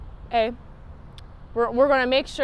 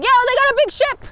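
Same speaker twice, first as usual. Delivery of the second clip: much higher, fast, excited mobster voice; mob boss; 12 semitones higher, 7 dB louder, much faster than usual. Retold or imitated. Mob boss returning from the ship in a squeaky rattled voice. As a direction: blurt it out like a rattled gangster.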